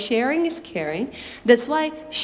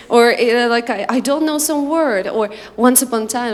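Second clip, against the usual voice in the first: ordinary talk, nothing marked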